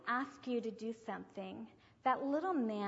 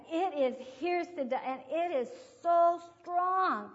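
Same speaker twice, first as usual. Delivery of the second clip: loud, higher, drawn-out alarm; urgent cry; 7 semitones higher, 11 dB louder than usual. Retold or imitated. Boy running to his teacher about his inner struggle, loud and urgent. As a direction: act out a child urgently reporting, stretching the words. Retold boy's urgent report to her.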